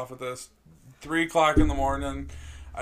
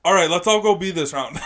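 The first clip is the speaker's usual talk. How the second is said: pissed off; 4 semitones higher, 7 dB louder than usual. Retold or imitated. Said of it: "you can just tell in his voice he's super pissed off".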